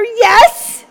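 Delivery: put-on high, loud kid's yes; grumpy little girl. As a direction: bark out a cranky child's yes. Hungry grumpy girl answers mom.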